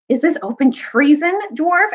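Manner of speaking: high, indignant accusation; outraged challenge. Acted. King accuses the dwarf of treason, outraged. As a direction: challenge him with royal outrage.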